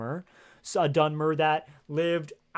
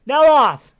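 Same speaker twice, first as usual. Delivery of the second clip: sudden loud cry of the name, pitched high; startled shout; 9 semitones higher, 13 dB louder than usual. Acted. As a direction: yell his name like you've been caught out. Startled by character's disapproval.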